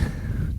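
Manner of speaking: airless little laugh; breathless laugh. Real, not acted.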